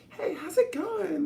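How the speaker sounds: very high, slow, bubbly hey; mock a chirpy LA stranger; high-pitched LA greeting. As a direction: falsetto valley greeting. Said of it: "high pitched like that".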